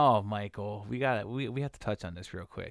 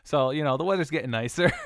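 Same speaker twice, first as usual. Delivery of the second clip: loud, high and delighted; cheerful loud exclamation; excited; 5 semitones higher, 7 dB louder than usual. Real, not acted. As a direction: announce the good weather gleefully. Excited about the weather.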